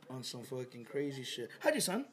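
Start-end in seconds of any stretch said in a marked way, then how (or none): 1.6-2.1 high, excited announcer impression; TV commentator voice. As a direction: play a hyped cooking show host. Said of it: "And they'd be like".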